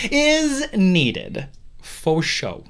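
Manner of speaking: drawn out very slowly; catchphrase. Acted. Host leads listeners in a running catchphrase, slowly. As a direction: stretch it out like a chant. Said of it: "say it with me".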